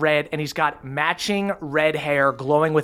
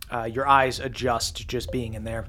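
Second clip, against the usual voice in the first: ordinary talk, nothing marked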